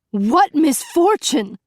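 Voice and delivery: high, slow screamed question. A narrator's reading. Princess screaming back at the captain, high and slow. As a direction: shriek the question in outrage. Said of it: "Beauty screamed".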